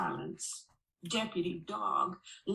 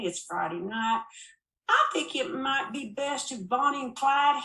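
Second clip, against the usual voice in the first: higher, louder, mocking lawman; smug sheriff; 6 semitones higher, 4 dB louder than usual. Sheriff deciding to jail Bonnie and Clyde, smug and louder. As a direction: toy with the suspects.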